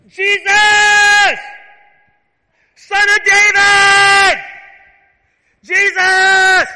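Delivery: screaming high and loud, stretched slow; desperate shout. Shown as a demonstration. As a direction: cry out with everything you have. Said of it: "I think he was like".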